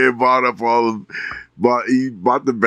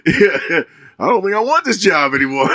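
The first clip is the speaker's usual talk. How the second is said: high voice, chuckling as he speaks; giggling, half-mock resignation; laughing through it; 8 semitones higher than usual. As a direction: laugh while giving the defeated line. Laughing retelling of comic line.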